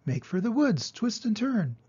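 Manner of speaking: very high, quick goose voice; a squawking goose coaching; the goose directing Wilbur's escape in a very high voice; goose giving orders. A narrator's reading. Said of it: "began to give orders".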